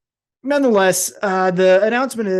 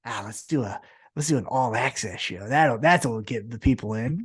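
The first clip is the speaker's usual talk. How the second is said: mock dismissive exec; 6 semitones lower than usual. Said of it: "you say".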